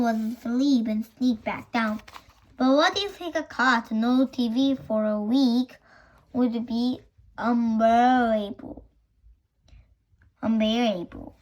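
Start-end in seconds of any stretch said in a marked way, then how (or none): none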